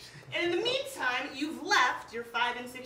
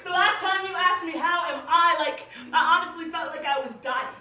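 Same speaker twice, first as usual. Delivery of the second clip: intense slam delivery, building louder; spoken word poem; 4 semitones higher, 7 dB louder than usual. Acted. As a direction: pour it out to the audience like a confession. Performs second spoken word poem.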